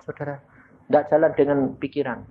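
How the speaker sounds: ordinary delivery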